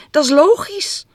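high, loud jubilant agreement; delighted squeal of agreement; joyful cry. A narrator's reading. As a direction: exclaim it, thrilled. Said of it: "een verheugd stompje".

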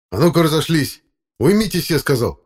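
loud, high commanding shout; stern shouted order. A narrator's reading. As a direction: break up the fight with a sharp order. Breaking up boys' fight.

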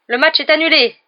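loud stadium-wide call; megaphone announcement. Acted. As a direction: McGonagall announcing through a megaphone. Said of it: "annonça ... dans le mégaphone".

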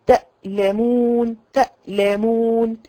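تألمون slow, the hamza held before the vowel; تألمون بإمساك الهمزة. Shown as a demonstration. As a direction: hold the hamza stop in تألمون. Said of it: "تمسكيها".

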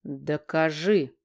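slow, low, each syllable separate. Acted. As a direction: spell out the demand slowly. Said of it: "Медленно, по слогам".

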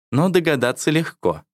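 lightly voiced teasing wife. A narrator's reading. Wife's voice in story.

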